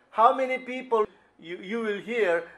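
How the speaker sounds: usual speaking voice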